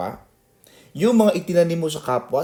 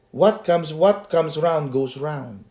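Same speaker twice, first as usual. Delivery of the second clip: slow emphatic proverb; much slower than usual. Shown as a demonstration. Preacher quotes proverb slowly.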